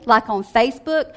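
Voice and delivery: ordinary delivery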